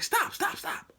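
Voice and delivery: high-pitched rapid-fire alarm; urgent. Retold or imitated. Instructor halting a bad parking attempt, high and urgent. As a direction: yelp it to halt the driver.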